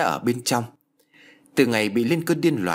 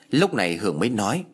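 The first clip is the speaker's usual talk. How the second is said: usual speaking voice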